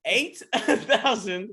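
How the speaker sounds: can't believe the number; stunned echo. Real, not acted. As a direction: draw it out in disbelief. Incredulous echo of attendance figure.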